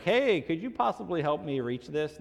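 polite request. Acted. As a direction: ask a stranger sweetly for a favor. Models a polite request, contrasted with yelling.

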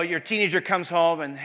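ordinary delivery

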